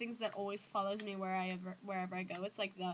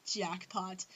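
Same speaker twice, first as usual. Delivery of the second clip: whispered, sly gloat; sly, delighted whisper; 3 semitones lower than usual. Real, not acted. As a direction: whisper it like a little villain. Gloating over known dirty secrets.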